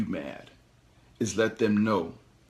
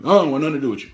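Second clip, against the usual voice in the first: louder, higher, curt brush-off; cold rebuff; 4 semitones higher, 8 dB louder, much faster than usual. Pretend. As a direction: shut the newcomer down hard. Rejecting someone who approaches.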